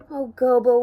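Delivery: plaintive storybook wail. A narrator's reading. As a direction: wail it out miserably. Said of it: "Wembley wailed".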